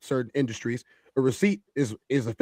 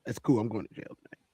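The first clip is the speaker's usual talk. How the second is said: low, soft, understated retelling; imitated cool resignation; 5 semitones lower, 8 dB quieter than usual. Man retelling another's words about jail, imitated cool resignation. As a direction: drop it low and quiet, imitating his calm emphasis. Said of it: "The emphasis on the words that was used was".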